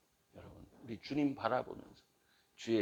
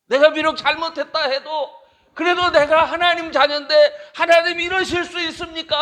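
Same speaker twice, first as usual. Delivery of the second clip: higher, louder crying plea; tearful lament; 20 dB louder than usual. Retold or imitated. A child of God protesting to God, raised tearful lament. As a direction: cry out to God in anguish. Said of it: "하면서 울었던 거예요".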